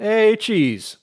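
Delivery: high, loud cheerful hey; excited greeting. Real, not acted. Cheering nickname.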